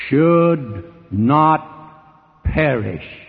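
very slow, word by word; grave, stretched-out pulpit warning; solemn quoting. Acted. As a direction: let "should... not... perish" toll out with long pauses. Preacher quotes the unwelcome clause weightily.

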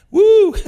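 high, loud delighted whoop. Real, not acted. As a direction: let out a gleeful "woo". Delighted whoop amid funny story.

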